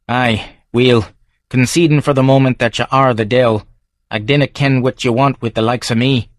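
gruff Edinburgh local sizing up a stranger; Davy's Scots brogue; Scottish barfly. Acted. Davy wary.

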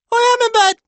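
put-on tiny squeaky boy, very high and loud; high-pitched child. A narrator's reading. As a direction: pipe up in a piercing little-kid squeak. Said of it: "a high-pitched voice replies".

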